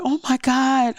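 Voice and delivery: reliving a rush of thankful relief; breaking into grateful exclamation; overwhelmed relief. Retold or imitated. Retold joy at good surgery news.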